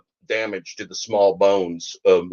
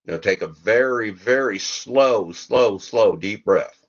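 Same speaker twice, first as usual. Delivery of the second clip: guided meditation; 2 semitones higher than usual. Acted. Leads a mock breathing meditation.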